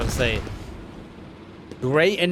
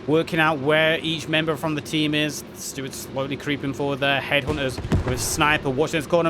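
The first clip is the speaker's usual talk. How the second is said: usual speaking voice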